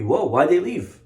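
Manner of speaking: indignant mock disbelief, rising at the end; acted angry alarm, pitch climbing; imitated angry outburst. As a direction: demand it like a ruler suddenly regretting. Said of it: "Paro gets angry".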